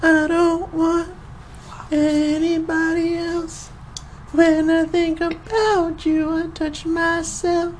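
very high, slow falsetto; creepy falsetto. Pretend. Cohost opens the podcast in a creepy slow falsetto.

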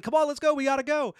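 high-pitched hurried plea, imitated; mock urgency. Movie fan retells the guy urging escape, mock urgency. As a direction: imitate someone urging the others along. Voices character urging others along.

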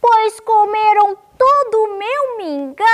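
loud, high, squeaky little cry; thin little cub voice, shouting; tiny-voiced baby bear. A narrator's reading. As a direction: wail it as a small bear cub. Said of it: "gritou com a sua vozinha fininha".

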